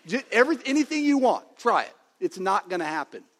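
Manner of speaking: high, loud, emphatic fatherly challenge; acted fierce insistence. Father telling upset son he can't leave the family, emphatic insistence. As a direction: dare him loudly, refusing to let go.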